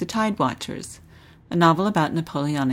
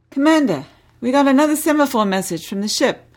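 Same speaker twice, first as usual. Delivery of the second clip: put-on higher, louder eager cabin boy call; cheeky boy calling out too loudly; 6 semitones higher, 4 dB louder than usual. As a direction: blurt it out louder than you should. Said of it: "The muffled words were a shade too loud".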